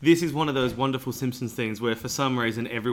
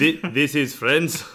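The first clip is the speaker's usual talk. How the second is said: put-on laughing Eastern European accent; vaguely Eastern European accent; 3 semitones higher, 4 dB louder, slower than usual. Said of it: "I went for a vaguely Eastern European accent".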